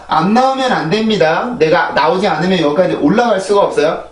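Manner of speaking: ordinary delivery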